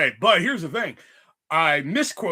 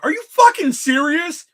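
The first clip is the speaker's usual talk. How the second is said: higher and louder, incredulous; almost snaps at the bosses; outraged disbelief; 10 semitones higher, 8 dB louder than usual. Retold or imitated. What he nearly said when pulled aside, incredulous and loud. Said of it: "on my tip of my tongue was like".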